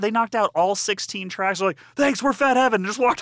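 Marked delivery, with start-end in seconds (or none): 1.9-2.8 high, loud punk frontman shout; imitated stage sign-off. Host playing the frontman leaving the stage, shouted sign-off. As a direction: holler a quick thanks to the crowd. Acts band signing off on stage.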